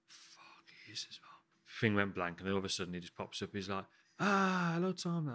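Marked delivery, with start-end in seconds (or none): 0.1-1.3 unvoiced swear under the breath; whispered curse. Chef waiting for Michelin's president to come on, whispered dread. Retold nerves before the president appears. 4.2-5.3 high-pitched, drawn-out hello; imitated cheery greeting. Michelin's president appearing on the video call, high-pitched greeting.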